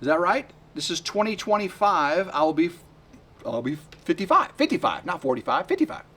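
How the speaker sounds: usual speaking voice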